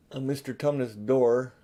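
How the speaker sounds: usual speaking voice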